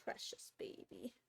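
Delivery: soft whisper to the cat; hushed, loving whisper to the cat; whispered cooing. Real, not acted. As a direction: breathe it out tenderly to a pet.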